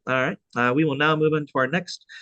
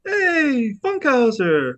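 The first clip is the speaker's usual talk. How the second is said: much higher, louder friendly hail; calling out to Larry; 12 semitones higher, 4 dB louder, much slower than usual. Retold or imitated. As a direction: call his name out like spotting a friend. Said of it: "he's like".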